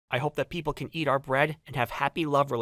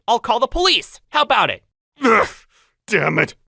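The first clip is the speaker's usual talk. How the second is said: ordinary talk, nothing marked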